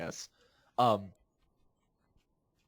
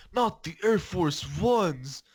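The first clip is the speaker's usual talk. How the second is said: whiny plea; 4 semitones higher, 3 dB louder, slower than usual. Retold or imitated. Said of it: "he would always say".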